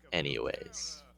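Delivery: ordinary delivery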